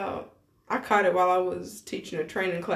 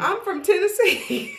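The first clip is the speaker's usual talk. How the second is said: high, slow Tennessee drawl; country accent; 9 semitones higher than usual. Shown as a demonstration. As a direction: show off the Southern twang, amused. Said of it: "That is my accent".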